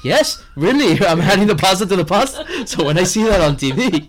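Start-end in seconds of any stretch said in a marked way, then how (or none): 0.0-2.4 flustered insistence. Retold or imitated. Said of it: "I'm like".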